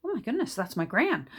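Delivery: high-pitched retold astonishment, breaking into laughter; delighted mock gasp of recognition; amazed realization. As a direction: relive a mind-blown moment, pitch leaping up. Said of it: "Blew, blew my mind".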